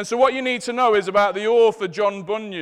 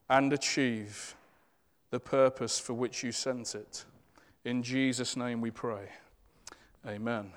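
quiet prayer; 5 semitones lower, 3 dB quieter than usual. Real, not acted. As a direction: pray gently over the church. Leads closing prayer.

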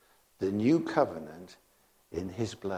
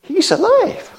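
much higher and louder, bursting; excited news; 11 dB louder than usual. Retold or imitated. Mary telling the disciples the news, high excited shout. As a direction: shout it like Mary running from the tomb. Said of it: "Mary coming and tell us".

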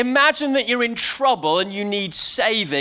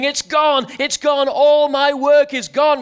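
higher, louder panicked cry of loss; frantic scream; 6 semitones higher, 4 dB louder than usual. Acted. Satan screaming at a blank screen, frantic loud wail. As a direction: wail it like the work just vanished.

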